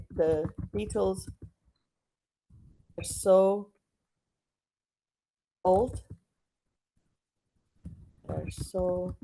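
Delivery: long pauses between each word; dramatic pauses. Shown as a demonstration. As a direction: break the sentence into slow pieces, fading at the end. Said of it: "I'm going to do a dramatic pause".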